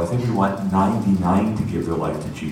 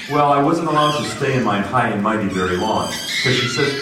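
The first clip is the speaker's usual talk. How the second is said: ordinary delivery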